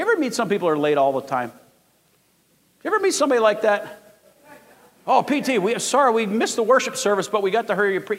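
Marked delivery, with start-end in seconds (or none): 5.1-8.2 mock apologetic latecomer. Late churchgoers making excuses.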